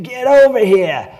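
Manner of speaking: put-on loud, high, drawn-out Maine accent holler. Trump voter beckoning the signature collector over, hollered Maine accent. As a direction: holler it slowly like a Maine local. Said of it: "I'm not making fun of that accent".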